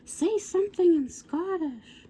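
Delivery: high-pitched mimicked girlish plea; excited fan demand. Girls beg Derek's supposed cousin to speak, imitated excited demand.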